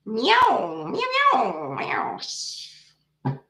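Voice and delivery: drawn-out, swooping cat meows; playful meowing. Pretend. Reader doing her kitty cat routine, playful meowing. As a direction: imitate a mewing cat. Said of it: "chit chat with kitty cat".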